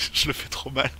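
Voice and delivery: ordinary delivery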